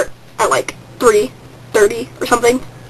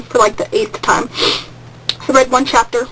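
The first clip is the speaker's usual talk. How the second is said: ordinary talk, nothing marked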